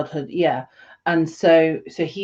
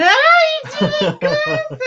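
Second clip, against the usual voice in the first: laughing tease; 9 dB louder, slower than usual. Real, not acted. Teasing partner about needing glasses.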